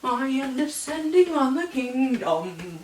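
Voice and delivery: menacing, high, ominous boast; dramatic villain. Acted. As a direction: announce doom over the kingdom. Dramatic villain line in blurb.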